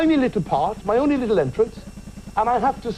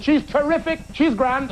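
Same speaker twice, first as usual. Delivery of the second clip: cheeky reply; 10 semitones higher than usual. Retold or imitated. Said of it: "I said".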